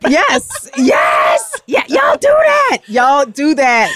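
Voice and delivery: loud, swooping, drawn-out agreement; emphatic agreement. Real, not acted. Cohost agrees with the listener's joke, hollering and emphatic. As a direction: holler it out, stretching the words.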